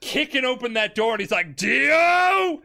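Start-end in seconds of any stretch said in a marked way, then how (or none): 1.5-2.6 a hero's furious battle cry; furious shout. Retold or imitated. JoJo bursting through the door, yelling at Dio in fury. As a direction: bellow the name, much higher and louder.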